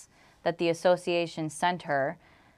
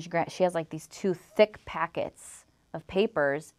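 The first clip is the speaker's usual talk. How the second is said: normal talking voice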